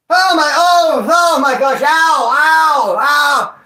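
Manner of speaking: loud high yelps of pain; pained cries. Retold or imitated. Remembering the shank wound, he yelps in pain. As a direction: cry out as if the wound just hit.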